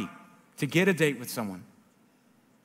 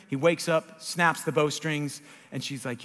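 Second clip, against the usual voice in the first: ordinary talk, nothing marked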